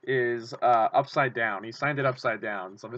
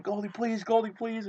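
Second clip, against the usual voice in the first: pleading fan; 9 semitones higher than usual. Retold or imitated. Begging player for autograph.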